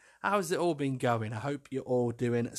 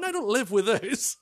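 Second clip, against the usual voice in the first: high retold denial on the edge of laughter; incredulous protest; 9 semitones higher, 3 dB louder than usual. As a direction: pitch it up, about to crack up.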